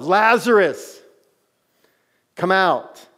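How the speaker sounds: loud, high commanding call; yelled command. Retold or imitated. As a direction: shout the name like an order into the tomb. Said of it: "Like, he yelled".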